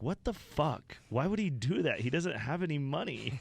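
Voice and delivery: high, incredulous what-the-fuck; imitating Brad's baffled outrage; mock outraged disbelief. Brad reacting to the paid dinner, high and incredulous. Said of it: "Brad was like".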